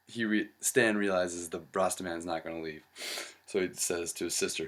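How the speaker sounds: ordinary talk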